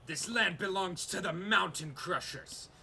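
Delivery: high, loud warrior orc war cry; proud orc leader shouting a claim. Acted. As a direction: bellow the claim like an orc chieftain. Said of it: "the female orc shout out".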